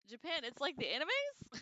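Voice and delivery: playful reading of a viewer's question; amused chat read. Real, not acted.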